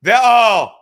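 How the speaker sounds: loud sudden "oh" of realization; startled shout. Real, not acted. Streamer reacting to a chat suggestion, surprised shout. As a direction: burst out as the idea hits.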